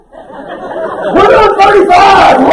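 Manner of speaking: high, drawn-out whooping yell; mock-thrilled shout. Driver thrilled at creeping past minimum speed, mock-thrilled shout. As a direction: whoop like a daredevil at top speed.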